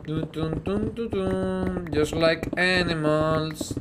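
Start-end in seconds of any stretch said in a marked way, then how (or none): none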